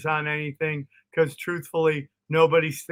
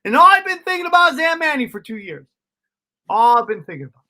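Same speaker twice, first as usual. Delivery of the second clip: much higher, louder, fast fixation; obsessed rush; 7 semitones higher, 5 dB louder, faster than usual. Retold or imitated. Obsessed buildup, high and loud.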